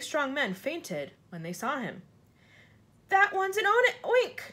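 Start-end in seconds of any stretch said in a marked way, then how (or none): 3.1-4.4 high, loud, frightened cry; screamed alarm. A narrator's reading. Fire chief spotting another monster, screaming. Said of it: "screamed the head of the fire department".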